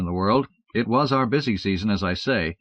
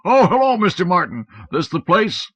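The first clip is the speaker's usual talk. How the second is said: loud, higher hearty Santa; jolly Santa; 9 semitones higher, 6 dB louder than usual. Acted. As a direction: greet him as a busy Santa. Santa greeting Martin.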